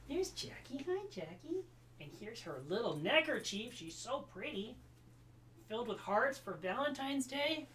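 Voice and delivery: high, soft cooing. Real, not acted. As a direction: coo sweetly at the little dog. Cooing to her dog.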